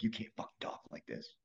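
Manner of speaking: harsh whispered self-scolding; inner-voice whisper. Retold or imitated. Comic recalling scolding himself over his Maryland accent, whispered. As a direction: say it under your breath at yourself.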